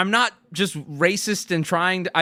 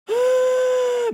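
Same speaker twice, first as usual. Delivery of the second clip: big exaggerated sigh; 5 dB louder than usual. Real, not acted. Groans about jet lag.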